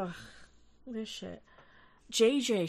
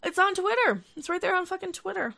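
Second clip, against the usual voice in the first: exasperated; 8 semitones higher, 4 dB louder than usual. Real, not acted. Exasperated about proof online.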